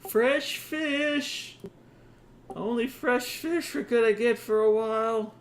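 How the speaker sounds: high, loud street-vendor cry; fish seller's shout. Acted. Cart seller hawking fish outside the window, high loud shout. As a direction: holler it out high, drawing in customers. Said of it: "some guy going".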